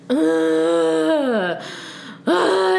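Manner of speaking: imitating groans; mock moaning; drunken groaning. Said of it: "all I hear is".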